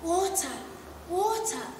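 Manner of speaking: a touch of parched pleading; thirsty pupil begging the teacher. A narrator's reading. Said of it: "begged Victoria Parfitt".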